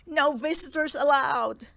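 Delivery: mildly weepy, firm reminder; sniffling insistence. Acted. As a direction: insist on the rule through sniffles. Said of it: "he sniffled".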